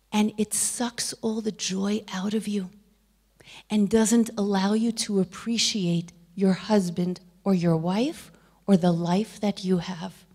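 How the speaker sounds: ordinary delivery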